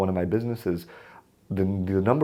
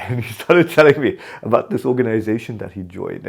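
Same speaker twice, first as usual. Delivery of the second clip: ordinary talk, nothing marked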